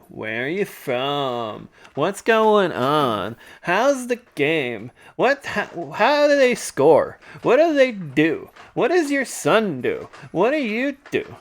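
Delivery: slow, high, drawn-out mock questions; flirty questioner impression. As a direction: pepper him in an exaggerated high singsong. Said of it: "starts peppering him with questions like".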